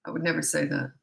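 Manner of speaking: ordinary talk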